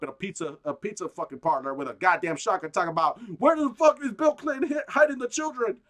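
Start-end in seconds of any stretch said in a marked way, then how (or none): 3.4-5.8 higher, louder frantic yell; mock conspiracy nut. Mocking the pizzagate shooter, higher and loud. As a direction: play a raving pizzagate gunman.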